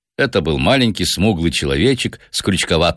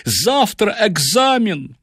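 high, drawn-out wail of dismay; panicked professor pleading; frightened; 13 semitones higher, slower than usual. A narrator's reading. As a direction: lament it slowly in a high, desperate voice. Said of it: "Профессор был поражён и напуган".

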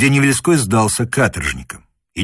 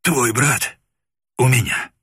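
wheezing rasp. Acted. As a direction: rasp it out through a crushed throat. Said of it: "просипел моряк".

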